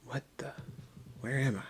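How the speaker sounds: mildly bewildered. Acted.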